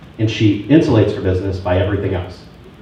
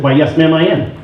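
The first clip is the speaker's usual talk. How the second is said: higher, sweetly proper retort; mock-polite reply; 6 semitones higher than usual. Agent answering a rival's wife, mock-polite. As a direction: answer with put-on courtesy.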